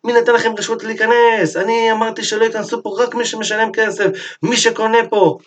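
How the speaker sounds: high-pitched mock yelling, no real shout; retold angry tirade. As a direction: rant indignantly as the outraged owner, pitch held high. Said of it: "הוא התחיל להרים צרחות, צעקות".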